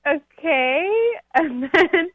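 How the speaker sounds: high, teary laughing okay; laugh-crying okay. Real, not acted. Accepting dog food in the dream, high laughing okay. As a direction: squeak it out through laughter.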